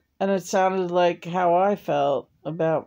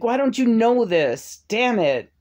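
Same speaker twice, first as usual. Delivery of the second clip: exasperated scolding; 7 semitones higher, 5 dB louder than usual. Retold or imitated. Scolding John in retold quarrel.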